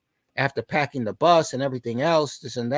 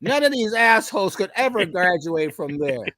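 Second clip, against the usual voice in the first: proud boss; 8 semitones higher than usual. Retold or imitated. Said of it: "He said".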